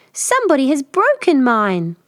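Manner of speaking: high squeak of a hurt cub; squeaky tiny bear. Acted. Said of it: "Tiny Bear squeaked".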